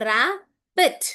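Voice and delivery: Rabbit broken in two, "ra", pause, "bit"; split into syllables. Shown as a demonstration. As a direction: sound out rabbit syllable by syllable with short vowels. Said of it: "when you hear a short vowel sound".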